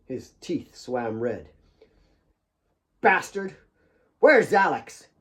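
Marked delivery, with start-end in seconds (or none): 3.0-5.1 gurgled. Acted. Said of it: "he gurgled".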